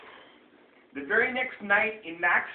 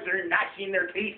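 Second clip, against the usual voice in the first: ordinary delivery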